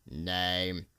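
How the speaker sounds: low, soft official asking; a tired clerk's one-word question; officer at the desk; bored officer. A narrator's reading. Immigration officer asks name.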